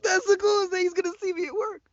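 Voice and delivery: squealing high with delight; high-pitched fanboy glee; giddy excitement. Retold or imitated. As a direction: gush like a starstruck fan. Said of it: "And I was like".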